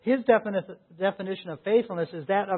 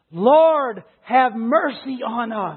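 acted loud, raised-pitch appeal; pleading cry; 5 semitones higher, 7 dB louder, slower than usual. Preacher quotes the blind men's cry to Jesus, pleading cry. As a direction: call out earnestly for mercy.

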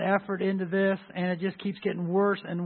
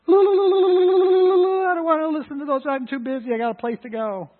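mock dismissive; 10 semitones higher, 9 dB louder than usual.

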